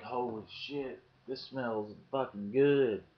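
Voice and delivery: slow, drawn-out, relishing each word; slowed, relishing delight; savoring amazement. Retold or imitated. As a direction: stretch it out like smelling fine whiskey. Smelling the opened can.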